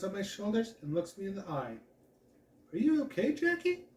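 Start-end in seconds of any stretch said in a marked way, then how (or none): 2.7-3.8 worried. Acted. Worried friend asks.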